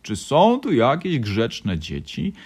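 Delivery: slow, loud put-on old man's voice; playful grandfather. A narrator's reading. Grandfather asking for good children, slow playful boom. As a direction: ask grandly, drawing it out.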